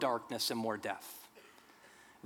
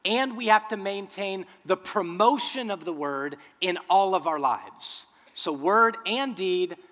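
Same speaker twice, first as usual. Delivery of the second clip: louder, emphatic preaching; forceful preaching; 3 semitones higher, 4 dB louder than usual. Acted. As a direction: drive each point home from the pulpit.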